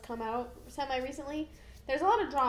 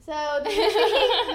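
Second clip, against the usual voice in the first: laughing; 9 semitones higher, 12 dB louder, much slower than usual. Real, not acted. Laughing start of review.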